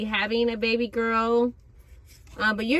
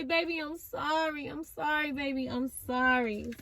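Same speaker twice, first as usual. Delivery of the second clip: much softer, tender begging sorry; hushed pleading lover; 9 dB quieter than usual. Pretend. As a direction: murmur the apology softly. Soft pleading apology.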